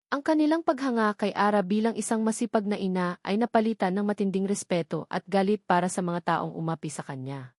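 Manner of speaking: usual speaking voice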